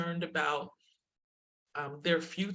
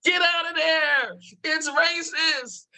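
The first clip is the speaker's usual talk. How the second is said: much higher, loud mock yell of the daughter; daughter's shout; 12 semitones higher, 7 dB louder, slower than usual. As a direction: belt it out like a panicked kid. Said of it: "She's like".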